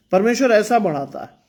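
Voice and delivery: ordinary talk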